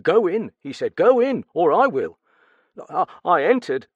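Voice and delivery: nervous stammer. Acted. Steward questioned about the murder, nervous stammer. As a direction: stumble over the words in fright.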